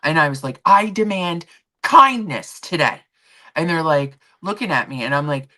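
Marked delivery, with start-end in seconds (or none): 0.7-3.0 loud, slow, emphatic order; retold boss's ultimatum; meltdown demand. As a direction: lay down the law, word by word. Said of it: "I had a meltdown".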